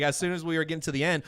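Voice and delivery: normal talking voice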